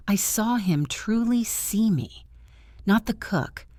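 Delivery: usual speaking voice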